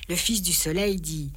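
plain reading voice

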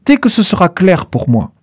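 plain reading voice

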